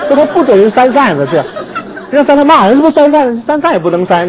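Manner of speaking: talking through laughter, pitched high; laughing. Real, not acted. Preacher laughing as he tells of a strict colleague. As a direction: giggle out the words about the fans.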